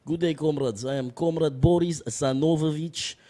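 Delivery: thick Russian accent, formal introduction; Boris Zanovovich. Shown as a demonstration. Guest introducing his Russian character to the host, Russian accent. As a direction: greet them as a Russian comrade. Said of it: "yeah, Russian".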